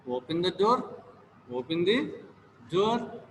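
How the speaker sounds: ordinary delivery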